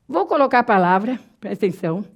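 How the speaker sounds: ordinary talk, nothing marked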